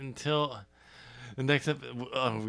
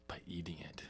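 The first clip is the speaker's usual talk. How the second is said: breathy unvoiced whisper, much softer; 7 semitones lower, 20 dB quieter, faster than usual. Real, not acted. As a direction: drop to a secret whisper for the punchline. Joke about eating the city.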